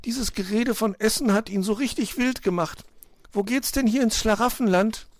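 high piping bird voice; eager little bird. A narrator's reading. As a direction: chirp it up high, hungrily. Hungry little bird keeps pestering.

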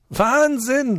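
laughing out. Acted. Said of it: "lacht Otto auf".

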